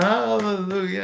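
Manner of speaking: high-pitched mock hallelujah; imitated rejoicing. Stranger handed scratch-off winnings, imitated jubilant cry. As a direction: cry out like someone overjoyed. Retold man rejoicing at money.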